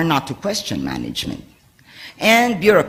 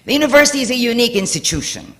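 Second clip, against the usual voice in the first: read much faster; 8 semitones higher, faster than usual. Real, not acted. Speaker reading a quote to the audience, fast. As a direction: rattle through the quoted text quickly. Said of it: "I'm going to read much more quickly".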